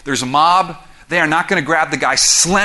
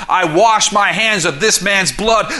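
slow, raised mock proclamation; loud declaration; 5 semitones higher, 5 dB louder, slower than usual. Pilate disowning the blame before the crowd, loud declaration. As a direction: declare it out like a retold official pronouncement.

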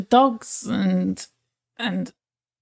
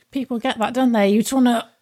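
ordinary talk

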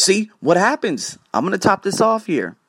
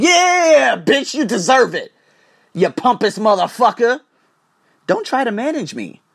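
higher and louder, yelling back in anger; angry shout; 7 semitones higher, 5 dB louder than usual. Retold or imitated. Retelling his furious reply to the pompous manager, shouting. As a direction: let the anger burst out at the boss.

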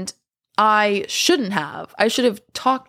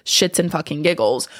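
ordinary talk

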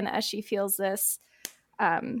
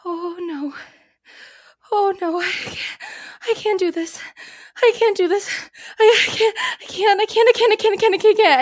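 rising, breathless panic, higher and louder as it builds; panicking; 11 semitones higher, 7 dB louder than usual. Acted. Pilot panicking over the comm, frantic and breaking. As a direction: spiral into a panic attack, I can't over and over. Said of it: "you just hear her start to go".

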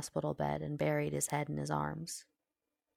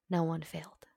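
whisper. A narrator's reading. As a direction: whisper it close and certain. Whispered repeat, eye contact.

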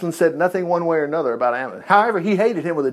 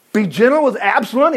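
raised, disbelieving question; incredulous echo; 5 semitones higher than usual. Acted. Preacher questions David's plea for his son, incredulous echo. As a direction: throw it back in disbelief, higher in pitch.

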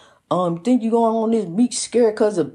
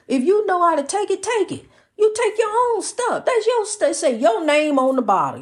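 much higher, sharp, fed-up scolding; exasperated retort; 11 semitones higher than usual. Retold or imitated. Wife retelling how she scolded her husband about his own pills, pitched high. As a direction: throw it back at him, done with it.

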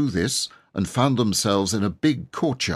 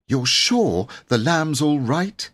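higher, slow, worried question; anxious; 8 semitones higher, 3 dB louder, slower than usual. A narrator's reading. Jane asks the cook anxiously about the lamb, higher and slow. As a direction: ask in real worry for the baby. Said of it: "asked Jane anxiously".

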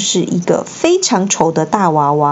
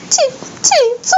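high, fast crying squeak; sobbing elf; much faster than usual. A narrator's reading. Dobby sobbing at Harry's kindness. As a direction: blubber it out through tears. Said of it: "他哭叫道".

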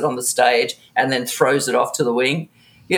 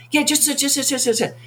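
high, hurried urging; 10 semitones higher than usual. Retold or imitated. Iggy hurrying her along.

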